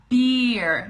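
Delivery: emphatic model of "beer"; clear B. Shown as a demonstration. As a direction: punch the B in "beer". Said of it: "the B and the V sound".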